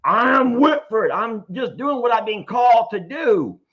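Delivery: loud, hammed-up Southern declaration; deep Southern voice. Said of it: "So in my deep Southern voice".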